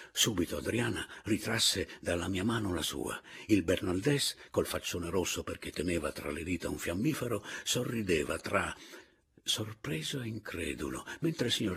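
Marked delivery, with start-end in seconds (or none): none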